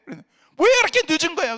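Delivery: high, loud exasperated yell; angry shout. Retold or imitated. Husband yelling at his wife how worried he was. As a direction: let it burst out of him.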